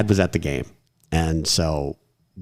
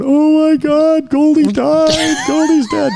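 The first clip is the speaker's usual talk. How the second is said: put-on high, slow, wailing child voices; little kids crying out; 7 dB louder, slower than usual. Little kids wailing to the crowd about the fallen mascot, high and slow. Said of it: "all you hear are these little kids going".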